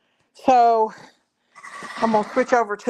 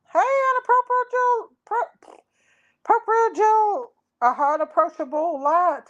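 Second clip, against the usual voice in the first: high silly voice; 10 semitones higher than usual. Real, not acted. Greeting viewer in odd voice.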